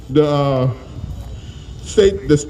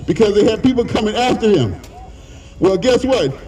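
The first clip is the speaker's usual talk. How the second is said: ordinary talk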